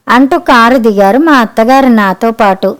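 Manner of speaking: usual speaking voice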